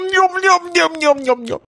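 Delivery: smacking lips like eating greedily; chewing noises. Pretend. Mock eating sounds. (சாப்பிடும் சத்தம்)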